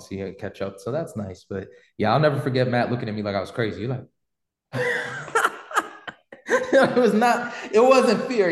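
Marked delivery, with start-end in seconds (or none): 4.4-8.5 high, loud laughing; slow words broken by laughter. Real, not acted. Laughing as he remembers Matt's face for the host.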